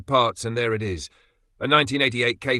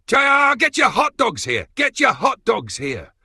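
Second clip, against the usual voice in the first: high, loud vendor hawking cry; full-volume yell; 14 semitones higher, 7 dB louder than usual. Acted. Hot dog vendor hawking to the car meet crowd, a high full-volume shout. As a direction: bellow it like a street vendor selling hot dogs. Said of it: "He yells at full volume".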